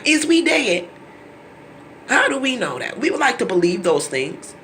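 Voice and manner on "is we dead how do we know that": "Is we dead?" higher and emphatic on "we"; emphatic "Is we dead?" stressing "we"; pointed question. Real, not acted. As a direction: lean on "we" in disbelief.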